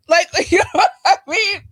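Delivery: ordinary talk, nothing marked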